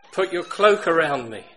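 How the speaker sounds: loud desperate plea for rescue; crying out to God. Retold or imitated. Hunted man on foot cries out to God, loud and desperate. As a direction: call out to God, spent and pleading. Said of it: "And he cried out and he said".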